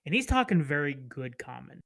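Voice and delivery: ordinary talk, nothing marked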